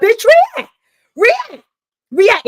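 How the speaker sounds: high, loud screech of challenge; shrill high taunt, loud; shrieking dare. Real, not acted. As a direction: screech it at him, daring. Yelling dare at Doctor G.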